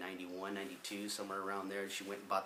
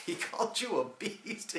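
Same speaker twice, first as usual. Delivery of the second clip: laughing while quoting; 12 semitones higher, 4 dB louder, slower than usual. Retold or imitated. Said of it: "He just turned around".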